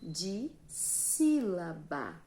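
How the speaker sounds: "dissílaba" broken after "di", with a long stressed "sí"; teacher giving the term piece by piece; slow teaching term. Shown as a demonstration. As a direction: draw out "dissílaba" for the class. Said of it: "ela vai se classificar como".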